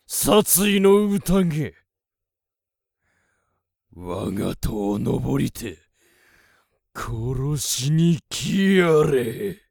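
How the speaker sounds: slow, loud, high menacing challenge; booming commanding warlord. Acted. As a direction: dare them to climb the tower. Wizard challenges the monsters.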